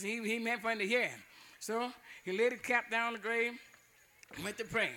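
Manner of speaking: usual speaking voice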